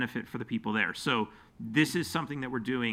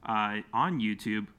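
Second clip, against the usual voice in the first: ordinary talk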